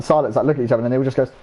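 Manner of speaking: ordinary talk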